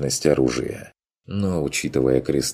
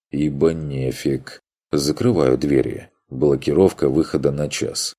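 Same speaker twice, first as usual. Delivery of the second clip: usual speaking voice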